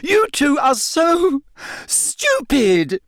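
high-pitched, drawn-out wailing cry; acted high wail of exasperation; tearful shout. A narrator's reading. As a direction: shout it high, stretching the words. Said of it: "she shouted".

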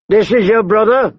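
high, called-out question; demanding question. A narrator's reading. Big man questioning the hostage's brother, demanding. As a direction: demand an answer from afar.

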